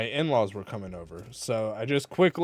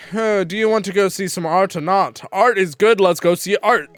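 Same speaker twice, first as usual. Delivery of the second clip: louder, higher mock character line; hammed-up character voice; 6 semitones higher, 8 dB louder than usual. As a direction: ham up the character's invitation. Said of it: "I was".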